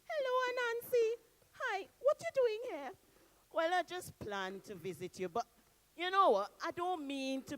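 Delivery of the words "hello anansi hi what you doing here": high, sweet woman's voice; Miss Daisy's voice. Acted. Miss Daisy greets Anansi at her house, high voice. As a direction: greet him as the lady of the village.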